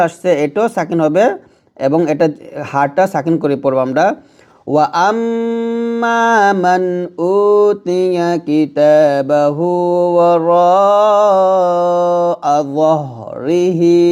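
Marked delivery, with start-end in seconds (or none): 4.7-14.0 slow, drawn-out recitation stopping on যাহরিহি; slow Quranic recitation. Shown as a demonstration. Teacher reciting the verse to students, slow chant. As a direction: stretch the vowels, end with a clear হ. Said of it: "থামলে হবে".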